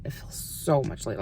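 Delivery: whispered repeat, under the breath; whispered echo. Real, not acted. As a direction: whisper it again to yourself.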